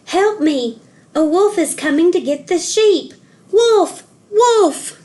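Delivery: high, loud cry for help building to wolf; shouting. A narrator's reading. Joseph calls down to his father, fake alarm shout. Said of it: "Joseph shouted down to his father".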